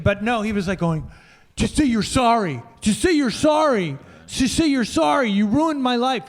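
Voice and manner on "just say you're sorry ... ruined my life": higher-pitched, repeated pleading demand; insistent mock plea, rising in pitch with each repeat; quieter mock screaming. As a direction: imitate a restrained scream, pitched up. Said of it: "He was just saying it quieter".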